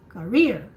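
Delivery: career with the ka swallowed and rear stressed. Shown as a demonstration. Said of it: "don't sound too much the first syllable".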